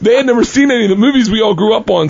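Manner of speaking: ordinary talk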